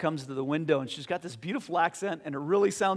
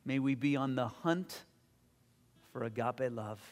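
closing prayer. Acted.

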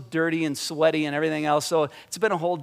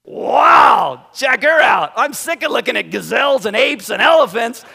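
loud, high, amazed exclamation; thrilled Adam shouting; excited Adam; 6 semitones higher, 9 dB louder than usual. Retold or imitated. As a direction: shout in delighted wonder. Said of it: "it's more like Adam going".

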